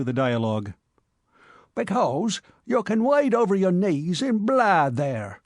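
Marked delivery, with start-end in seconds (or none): 1.7-5.4 put-on slow, higher croak of a shriveled old cabby; old cabman savouring a bloody boast, slow and high; gloomy old cabman. A narrator's reading. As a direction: relish the gore, slowly. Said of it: "said the mummy".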